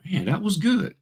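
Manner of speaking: high, admiring mock listener; impressed praise. Networking crowd praising her pitch, high and admiring. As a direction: toss it up high like a fan. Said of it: "they're like".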